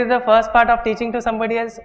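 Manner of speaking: ordinary delivery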